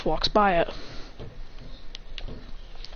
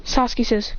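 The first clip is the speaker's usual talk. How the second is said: plain reading voice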